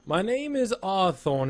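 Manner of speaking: high, slow, drawn-out elf greeting; Arthorn. Acted. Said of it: "he bows very graciously".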